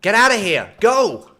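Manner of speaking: loud, impatient order; exasperated yell. Real, not acted. Streamer chasing off a game mob, shouting. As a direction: shout it to drive them off.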